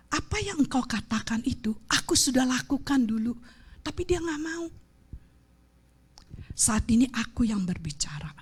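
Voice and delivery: softly whispered protest to God; hushed plea. Retold or imitated. Preacher retelling her reluctant answer to God, hushed whisper.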